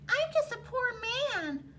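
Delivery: high whiny squeal, trailing off; whining. A narrator's reading. Leprechaun pleading to Tom, high whine. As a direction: whine like a caught little man. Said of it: "wiggled and twisted and whined".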